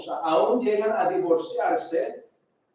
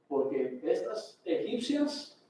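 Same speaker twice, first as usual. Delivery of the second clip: usual speaking voice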